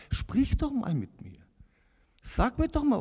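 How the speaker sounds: high, loud, pleading shout; slow, demanding yell upward; shouting at Jesus. Retold or imitated. A frustrated man shouting at a silent Jesus, loud and high. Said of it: "habe ich ihn angeschrien".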